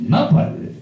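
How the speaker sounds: normal talking voice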